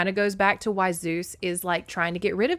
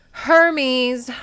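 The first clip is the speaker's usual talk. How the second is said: loud, raised summons; commanding; 7 semitones higher, 11 dB louder than usual. A narrator's reading. Zeus summons Hermes to answer, commanding. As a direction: call him out with authority. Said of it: "Zeus commanded".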